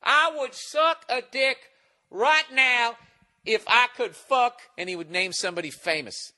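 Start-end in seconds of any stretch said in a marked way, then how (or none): 0.0-4.6 put-on high, stretched-out drunken declaration; mocked drunk guy. Drunk guy boasting to the whole bus, mocking imitation. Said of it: "He just kept going".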